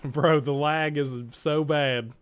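slow, laughing through it. Real, not acted. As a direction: laugh out the complaint. Laughing about the lag.

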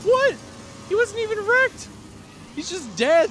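very high excited squeal; shrieking with glee. Real, not acted. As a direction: squeal with delight. Watching a car go flying.

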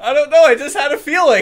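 loud, fast and laughing; shouted boast. Acted. The spy-spotter bragging loudly. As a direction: blurt it out proudly.

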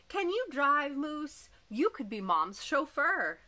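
bright hopeful question to the moose; eager child. A narrator's reading. Jack eagerly asks the moose to drive, high and loud.

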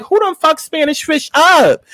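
high, slow, stunned exclamation; reacting to the bruised cashier, drawn-out and appalled; shocked outburst. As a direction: gasp it out in mock horror. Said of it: "And I'm like".

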